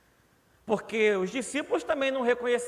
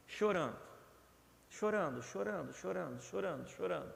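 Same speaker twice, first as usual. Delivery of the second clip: each chorando softer, trailing away; fading repetition; 8 dB quieter than usual. Acted. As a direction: let chorando die away, softer every time. Repeated weeping word, fading. (chorando repetido, cada vez mais baixo)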